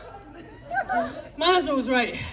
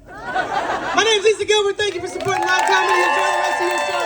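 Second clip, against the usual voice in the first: comedian sign-off; 5 semitones higher, 4 dB louder than usual. Real, not acted. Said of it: "sign-off".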